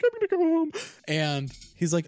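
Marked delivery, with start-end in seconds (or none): 0.0-0.7 very high, loud squeak of imitated near-crying; mock holding back tears. Telling friend he's going home, mock tearful squeak. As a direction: squeeze it out as if about to cry. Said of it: "trying not to cry".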